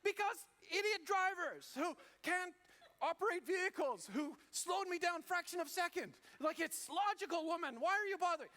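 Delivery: high, loud ranting shout; irate driver's retort. Acted. Husband yelling back at wife about bad drivers, furious rant. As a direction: rant back at full volume, hammering it out. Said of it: "You'd be like".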